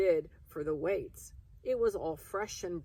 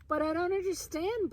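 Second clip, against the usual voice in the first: much higher, urgent outburst; 13 semitones higher, 4 dB louder than usual. Acted. Egan at supper bursting out about the cat, much higher. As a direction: burst out in disbelief. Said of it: "burst out Egan".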